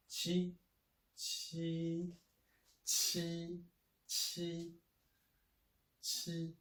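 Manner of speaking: qi held high and level, first tone. Shown as a demonstration. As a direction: say qi high and flat, repeated. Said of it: "the first tone".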